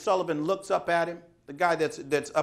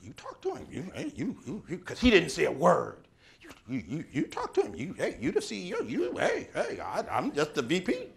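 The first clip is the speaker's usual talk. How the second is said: squeaky, scared whispers passing the buck; mock nervous executives. Nervous executives push each other.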